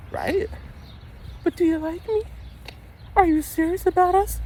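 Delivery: very high pleading imitation; mock needy girlfriend. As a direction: squeak it up like the clingy girlfriend. Mimicking girlfriend's needy questions.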